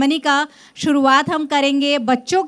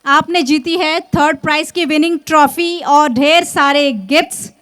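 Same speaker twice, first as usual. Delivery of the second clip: normal talking voice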